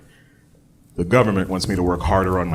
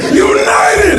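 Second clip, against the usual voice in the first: high, loud football chant; fan shout; 7 dB louder than usual. Retold or imitated. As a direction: scream it like a rioting fan. Fans chanting at a riot.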